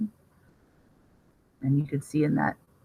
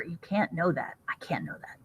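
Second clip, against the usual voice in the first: whispered; 9 dB quieter than usual. Acted. Vet answering Clint.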